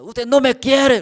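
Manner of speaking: very loud, high, slow self-pitying cry; drunken wailing complaint. Retold or imitated. Drunk brother crying out to the pastor that he isn't loved, loud and slow. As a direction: whine it at full volume like a hurt drunk.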